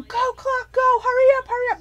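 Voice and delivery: high, loud yell at the game clock; cheering yell. Retold or imitated. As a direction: urge the clock on like a frantic fan. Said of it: "I was yelling".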